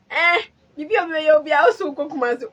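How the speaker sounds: loud, high teasing shout; mock shout. Mother mimicking son's question with a loud teasing shout. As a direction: throw the son's line back loud. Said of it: "I was laughing".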